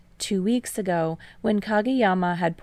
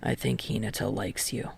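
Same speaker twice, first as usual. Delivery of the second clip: low, soft confession; confiding; 8 semitones lower, 7 dB quieter than usual. A narrator's reading. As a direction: say it low and plain.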